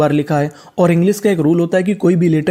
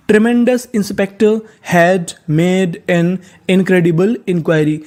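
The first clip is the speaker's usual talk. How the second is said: sentence read very slowly, word by word; slow careful reading pace; slow model reading; much slower than usual. Shown as a demonstration. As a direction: dictate it slowly to the class. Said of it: "full sentence read".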